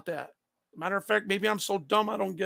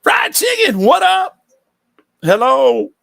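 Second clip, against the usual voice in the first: much higher, loud hyped greeting; excited shout-out; 7 semitones higher, 13 dB louder, slower than usual. Real, not acted. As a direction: call out to the chat like spotting a friend.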